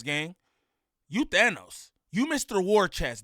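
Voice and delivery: normal talking voice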